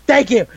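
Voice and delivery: high, emphatic "Thank you"; "Thank you" thrown out with exaggerated force; said really hard. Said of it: "they say it really hard".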